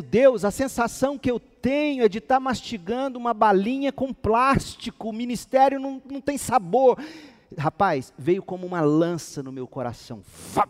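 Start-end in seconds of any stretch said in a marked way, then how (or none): 0.0-7.0 higher, louder pleading cry to God; desperate prayer. Retold or imitated. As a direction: pour out the complaint on your knees, pitched up. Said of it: "ajoelhado e dizendo".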